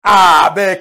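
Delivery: ordinary talk, nothing marked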